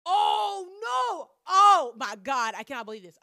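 high, loud panicked cry of disbelief; mock panic. Retelling her panic when the secret got out, loud high wail. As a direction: wail it like the world is ending. Panicked reaction to word getting around.

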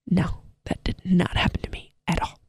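soft whispered aside, jokingly denying it; whispered tongue-in-cheek denial; sarcastic whisper. Real, not acted. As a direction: drop to a breathy whisper for the joke.

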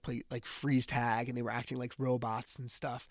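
ordinary talk

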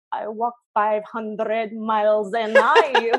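slow, drawn-out mock Scottish accent. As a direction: slowly ham up a Scottish accent like the old song. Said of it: "the Scottish accent".

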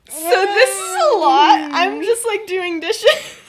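high, loud, slow, through laughter; overwhelmed, mock. Woman hearing a confession over the dishes, overwhelmed mock reply. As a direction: play taken aback while trying not to laugh.

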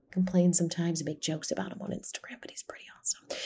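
hushed, breathy confiding whisper; whispered aside. Real, not acted. Whispering a fond confession about her husband to viewers. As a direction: lean in and whisper it like a secret.

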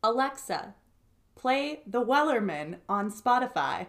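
careful, projected device command; perfectly enunciated. Real, not acted. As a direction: say it clearly so the device hears you. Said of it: "You said that so perfectly".

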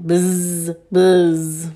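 low buzzing bee imitation; low droning bee. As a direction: buzz low, holding the z. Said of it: "sound like a bee".

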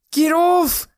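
very high, loud hysterical yell; top-of-the-voice terrified shriek; screaming. A narrator's reading. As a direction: scream it in panic. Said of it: "screamed at the top of his voice".

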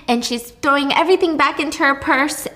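ordinary delivery, nothing marked

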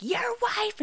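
high-pitched mock screech; hammed-up accusing cry; imitated yelling. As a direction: mimic an outraged woman yelling at the husband. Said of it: "She just wants to yell at me".

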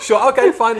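high, loud sarcastic okay; sarcastic agreement. Pretend. Mock agreement with going on.

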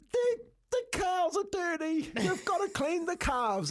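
put-on high, slow, upset little girl voice; imitating daughter. Daughter telling dad the calves are dirty, high whiny imitation. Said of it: "like this".

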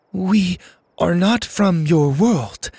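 high, slow, deliberate alien speech; clipped, desperate. A narrator's reading. Lainey assures the driver they are aliens, clipped and desperate. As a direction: impersonate an alien, earnest and measured. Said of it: "Her tone was strangely clipped".

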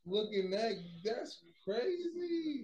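stunned disbelief. Real, not acted. Telling his friend about the rejection, stunned and slow. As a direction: drag it out in disbelief. Shocked reaction to the scene.

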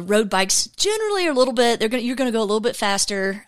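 rushing the words out; racing through it breathlessly eager; pressured rushing speech. Real, not acted. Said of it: "I'm talking a mile a minute".

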